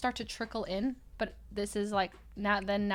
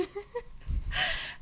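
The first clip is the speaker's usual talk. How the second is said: squeaky breathless laugh; high squealing laugh; 11 semitones higher, 4 dB quieter than usual. Real, not acted. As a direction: laugh high, almost crying. Laughing at drunk boyfriend.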